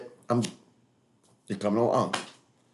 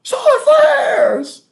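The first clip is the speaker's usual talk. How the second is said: slow, high, loud hammed-up yell; mock shout; 8 dB louder, much slower than usual. Mocking the rival's solar flare show, hammed-up shout. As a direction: bellow it like a doomsday prophet.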